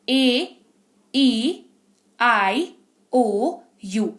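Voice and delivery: each vowel said slowly with pauses; call and repeat vowels. Shown as a demonstration. Teacher modelling vowels for students to repeat. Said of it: "मेरे साथ वापस से बोलो".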